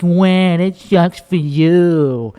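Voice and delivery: slow, drawn-out sympathetic wah; slow mock sympathy. Shown as a demonstration. Comedian shows his sympathy to a venting friend, slow mock whine. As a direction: stretch it out slowly as a pitying whine. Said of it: "I mean, like".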